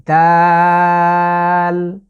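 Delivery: দাল drawn out and loud; long Arabic dal. Shown as a demonstration. Teacher shows viewers the letter dal, drawn out. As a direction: hold দাল for three counts. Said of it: "আমি জিভের আগাটাকে".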